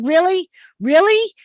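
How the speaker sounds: rising, incredulous "Really?" pitched high; mock outrage climbing each time; indignant disbelief. [name] protesting to the nurses, rising incredulous disbelief.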